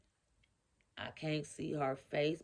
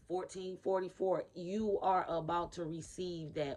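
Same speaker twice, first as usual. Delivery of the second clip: ordinary talk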